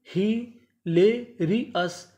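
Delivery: Hilarious split slowly as हि-ले-रि-यस; very slow teaching breakdown of hilarious; syllable by syllable. Shown as a demonstration. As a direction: pause between each chunk of hilarious. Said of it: "टुकड़ों में तोड़ तोड़ के पढ़ें".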